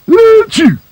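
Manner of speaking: high sharp achoo; loud sneeze. Acted. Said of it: "sneezed Sad Sack".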